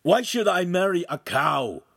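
affronted suitor; indignant refusal. A narrator's reading. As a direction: protest in disgust. Appalled suitor refuses the match.

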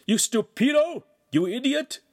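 very high, loud raging yells; furious roar. A narrator's reading. Master roaring at the bleeding boy. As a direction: bellow insults at the fallen boy. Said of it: "continued to roar at him".

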